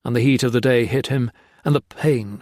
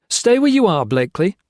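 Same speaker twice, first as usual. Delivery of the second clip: sharp raised call to stop him; firm command; 8 semitones higher, 5 dB louder than usual. Acted. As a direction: order him to stay put, loudly. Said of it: "Gray said".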